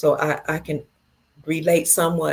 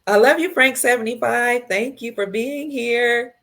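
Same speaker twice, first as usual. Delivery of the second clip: excited greeting; 6 semitones higher, 4 dB louder than usual. Real, not acted. Greeting a viewer warmly.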